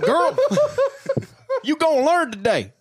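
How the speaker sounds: high, loud mock dad, laughing; mock Southern dad scolding, high and loud; hammed-up loud dad. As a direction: bellow at the kid through laughter.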